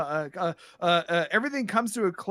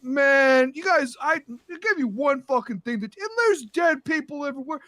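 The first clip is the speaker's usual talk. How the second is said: upset boss with voice pitched up; exasperated steward; 7 semitones higher than usual. Acted. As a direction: scold the whole staff in dismay. Steward scolds staff over deaths.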